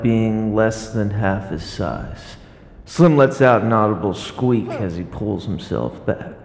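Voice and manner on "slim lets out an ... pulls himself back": normal talking voice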